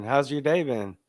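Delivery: ordinary talk, nothing marked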